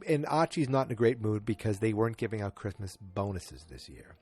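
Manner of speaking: ordinary talk, nothing marked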